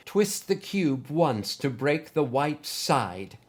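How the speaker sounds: slow, steady, soothing command; slow calm voice in his head; calm instruction. Acted. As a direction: guide him gently through it. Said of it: "calmly instruct him".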